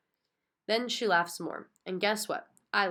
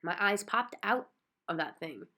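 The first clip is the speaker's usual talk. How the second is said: usual speaking voice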